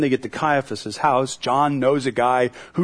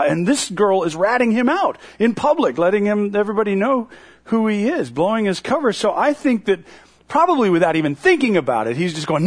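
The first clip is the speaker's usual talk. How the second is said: usual speaking voice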